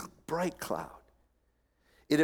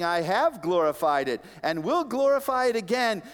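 raised, forceful proclamation; voice from heaven; 9 semitones higher, 5 dB louder than usual. Retold or imitated. Preacher voicing God answering his Son, raised proclamation. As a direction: declare it as God answering from above. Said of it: "a voice came from heaven saying".

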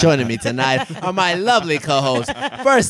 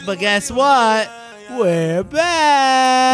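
excited; 7 semitones higher, much slower than usual. Real, not acted. Hyping the show's return.

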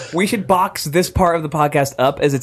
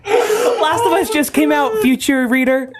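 laughing through words; 10 semitones higher than usual. Real, not acted. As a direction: barely get it out for laughing. Laughing while addressing future listeners.

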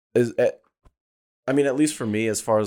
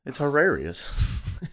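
slow, higher, savoring hilarious; drawn-out hilarious; 5 semitones higher, much slower than usual. Real, not acted. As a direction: stretch it out, teasing. Repeats the claim, drawn out.

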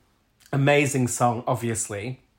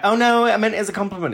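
fake innocent; 9 semitones higher, 5 dB louder, faster than usual. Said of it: "Don't make out like".

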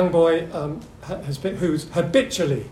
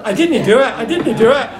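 put-on high, loud Scottish boy protesting; mock Glasgow schoolboy wail in broad Scots, knowingly hammed up; Scottish accent; 8 semitones higher, 8 dB louder than usual. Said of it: "in a Scottish accent".

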